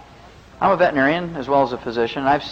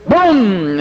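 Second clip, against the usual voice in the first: loud sudden mock gunshot, pitched up; gunshot boom; 7 semitones higher, 7 dB louder than usual. Farmer shooting the bull, a loud mock gunshot boom. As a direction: bang it out like a shotgun blast.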